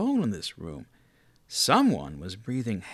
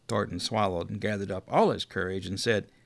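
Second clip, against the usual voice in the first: ordinary delivery, nothing marked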